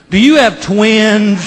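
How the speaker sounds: put-on slow, high, sweet checkout girl; innocent question. Register girl asks about the cakes, slow and sweet. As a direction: stretch it out innocently.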